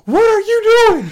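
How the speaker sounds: very high, loud, squealing mock coach; hammed-up baffled yelling coach; frantic coach. Coach yelling at the umpire in his box, shrill mock panic. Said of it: "the coach is going".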